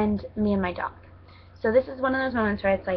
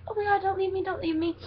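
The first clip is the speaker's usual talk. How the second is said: much higher, whiny pleading dog voice; 11 semitones higher than usual. Pretend. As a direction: beg like an abandoned puppy. Voicing the abandoned dog.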